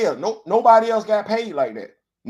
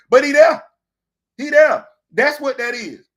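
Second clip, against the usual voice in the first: higher and louder, mocking disbelief; loud incredulous jab; 7 semitones higher, 8 dB louder than usual. Real, not acted. Commentator to fans about Davis, loud mocking disbelief. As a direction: throw it out loud, half laughing at him.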